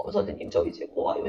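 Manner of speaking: soft, whispered, grieving confession; breathless whisper of loss; whispered grief. Retold or imitated. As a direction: barely voice it, as if choked up. Student confesses being an orphan.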